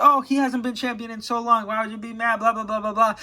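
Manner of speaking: mock the comment section; mocking commenters. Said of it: "say in the comments".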